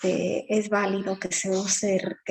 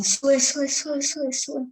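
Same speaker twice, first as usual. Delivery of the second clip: "sube" rattled off fast and higher, clipped to "sue, sue"; rapid bus call; 5 semitones higher, slower than usual. Shown as a demonstration. Bus fare collector calling passengers aboard: rapid clipped chant. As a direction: call it like a bus caller hurrying riders on. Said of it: "con un ritmo tan acelerado".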